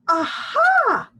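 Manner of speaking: high, loud delighted "Aha"; happy exclamation. A narrator's reading. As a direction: cry out in joy at the discovery. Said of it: "Dolores happily exclaimed".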